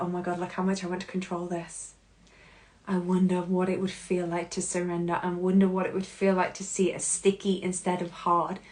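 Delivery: quiet, musing inner voice; hushed, reflective self-talk; soft wondering. Acted. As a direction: wonder it gently to yourself. Voicing a gentle inner realization.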